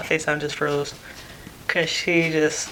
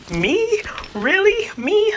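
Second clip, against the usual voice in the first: high-pitched, squeaky disbelief; each word shoots up high, questioning; mock-incredulous at your own news; 4 dB louder, much slower than usual. Retold disbelief at twins news.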